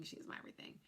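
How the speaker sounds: whispered, barely there; hushed whisper about her mother. Real, not acted. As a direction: breathe it out softly, just for yourself. Tender words about her mother.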